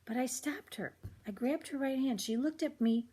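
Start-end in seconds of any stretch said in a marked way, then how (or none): none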